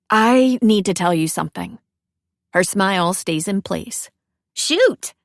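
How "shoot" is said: bright prompt. Acted. Cece prompts Elsie to talk.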